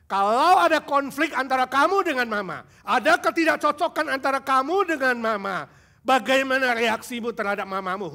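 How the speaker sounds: fervent preacher pressing the congregation; preaching at full force. Acted. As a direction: preach it hard to the youth.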